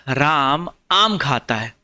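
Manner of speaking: राम आम खाता है said very slowly, word by word; slowly spelled out. Shown as a demonstration. Teacher reading the sentence slowly to the class. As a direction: draw out each word slowly so students follow. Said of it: "वाच्य का कौन सा रूप है".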